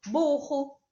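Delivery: "burro" with a strong guttural R in the middle; double R. Shown as a demonstration. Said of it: "E o dos dois R's".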